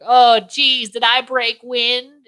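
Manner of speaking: high, slow old-lady impression; dotty old aunt. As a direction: draw it out high, innocently oblivious. Plays old Aunt Bethany in retelling.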